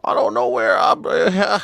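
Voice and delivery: put-on high-pitched, befuddled old man; hammed-up elderly man voice; ancient Uber driver. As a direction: play a very old driver lost and confused. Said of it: "guy driving is eighty-five years old".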